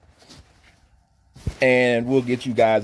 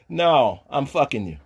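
usual speaking voice

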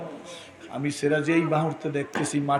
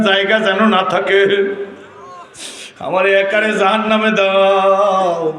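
imitating a crying shout; 7 semitones higher, 8 dB louder than usual. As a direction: act out a loud plea, stretching the endings. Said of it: "চিৎকার মেরে বলে". (চিৎকার করে আকুতির অভিনয়)

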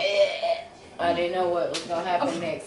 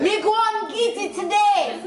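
high, loud mock Jamaican accent; hammed-up Irish Jamaican accent; 13 semitones higher, 5 dB louder than usual. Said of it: "That's an Irish Ja-, Jamaican accent".

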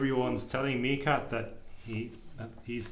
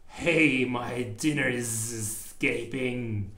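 indignant snake; slower than usual. Acted. Snake voiced chasing.

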